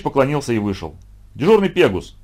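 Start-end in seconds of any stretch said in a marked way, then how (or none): 1.3-2.2 high-pitched, forceful teacher's call; thundering summons. A narrator's reading. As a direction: boom out the culprit's name. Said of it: "загремел Пифагор". (громовой окрик)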